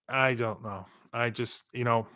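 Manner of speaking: hoarse voice from allergies; losing voice. Real, not acted. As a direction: voice going hoarse and worn. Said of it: "I'm losing my voice because of allergies".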